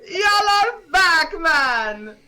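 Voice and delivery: high, loud, wailing welcome; excited Panda. Acted. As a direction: cry out to returning heroes, very high. Game master voices Panda's greeting.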